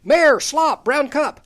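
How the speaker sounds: loud, high mock calling; mock yelled nonsense. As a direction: yell gibberish as if calling him back. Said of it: "Might as well be saying".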